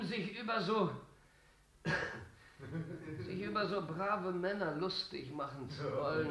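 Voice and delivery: weakening, faltering voice. Real, not acted. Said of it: "actor falters, struggling on stage".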